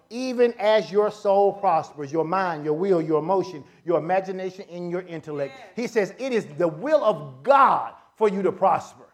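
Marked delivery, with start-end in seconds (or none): none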